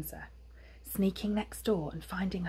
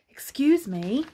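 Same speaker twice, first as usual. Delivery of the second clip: raised, insistent polite call; strange neighbour's voice; 7 semitones higher, 6 dB louder than usual. A narrator's reading. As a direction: get their attention, higher and louder. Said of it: "hedgehog from five B ... said again".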